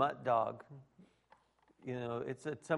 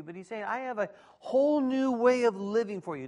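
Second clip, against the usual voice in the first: higher, louder sermon voice, driving the point home; preaching at full force; 8 semitones higher, 3 dB louder than usual. Acted. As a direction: preach it out to the room, raised and ringing. Pastor preaching to congregation.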